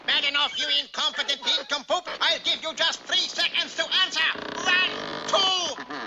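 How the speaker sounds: rising furious tyrant tirade; ranting dictator speech. Acted. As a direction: count down in rising fury. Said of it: "As the mad dictator's voice rose".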